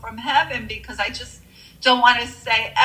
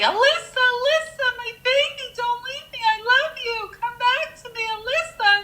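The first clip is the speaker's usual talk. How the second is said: imitated pleading cry; faster than usual. Mother begging over her daughter's casket, imitated high pleading cry. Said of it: "She was screaming, crying, pleading with me".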